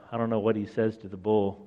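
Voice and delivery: ordinary delivery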